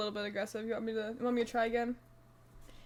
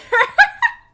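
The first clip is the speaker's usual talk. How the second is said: shrieking laugh; 18 dB louder than usual. Real, not acted. Bursts out laughing at joke.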